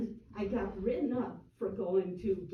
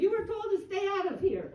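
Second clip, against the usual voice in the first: high-pitched mock scolding; charging at her; 12 semitones higher, 4 dB louder than usual. Board member Ray ordering her out, high mock scolding. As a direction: imitate an aggressive order, pitch way up. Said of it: "came charging around and said".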